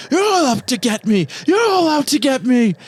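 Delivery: high, loud paranoid shriek; paranoid Donald. Acted. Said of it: "And Donald's like".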